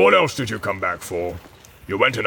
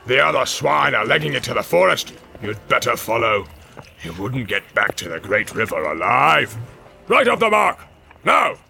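barking orc commands. Acted. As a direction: shout the troops onward. Ugluk commanding.

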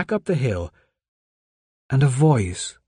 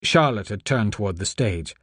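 ordinary delivery

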